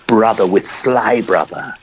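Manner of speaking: ordinary talk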